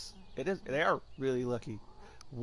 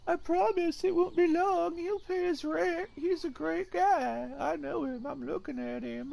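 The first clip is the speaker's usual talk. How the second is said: much higher, slow, sing-song imitation of the roommate; mocking roommate voice; 14 semitones higher, slower than usual. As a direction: parody how the roommate sounds in his head. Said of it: "that's how you talk".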